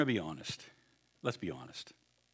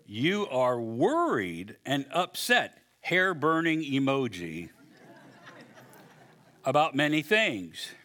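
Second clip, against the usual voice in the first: slow, deliberate; slower than usual. Retold or imitated. Slow reading of Jesus.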